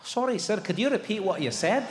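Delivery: thick Scottish lilt; politely puzzled, in broad Scots; Scottish accent. Acted. Indian waiter asks for a repeat, Scottish accent. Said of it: "He's Scottish!".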